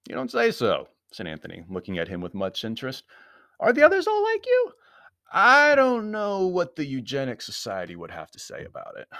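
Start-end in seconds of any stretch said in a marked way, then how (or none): 0.1-0.9 high-pitched, teasing surprise; mock amazement. A narrator's reading. Anthony mocking the comrade's announcement, high mock surprise. 3.6-9.1 very high, loud mock wonder sliding down; flippant Anthony, mocking. A narrator's reading. Anthony needling the villain about his comrades, high and flippant.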